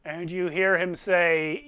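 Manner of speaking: normal talking voice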